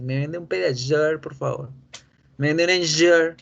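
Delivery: PEDIASURE and ENJUR with exaggerated zh; pronunciación en inglés. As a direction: customer asking with English zh in ENJUR. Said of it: "a la droguería y decir". (cliente pidiendo ENJUR con zh inglesa)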